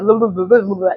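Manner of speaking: rapid jumbled babble; too-fast self-imitation. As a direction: imitate yourself tripping over your own words. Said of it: "I usually talk so fast that I be talking over myself".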